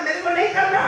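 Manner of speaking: high, loud flat no; emphatic refusal. Retold or imitated. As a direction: shut him down hard. Maulana flatly refuses.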